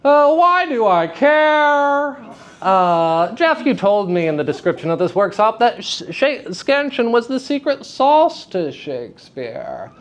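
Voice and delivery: high-pitched mock audience member; sceptical attendee. Voices a sceptical workshop attendee.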